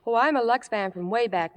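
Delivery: usual speaking voice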